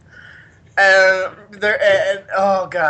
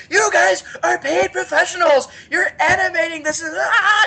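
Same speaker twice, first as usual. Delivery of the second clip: high-pitched, outraged mock rant; going crazy; 12 semitones higher than usual. Orc expert berating paid animators, going crazy. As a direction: build from scolding to an exasperated scream. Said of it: "I just went crazy on them".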